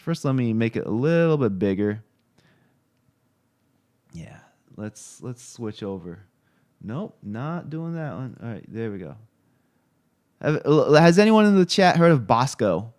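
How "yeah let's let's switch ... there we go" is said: muttered aside. Real, not acted. Muttering while switching screens off-mic.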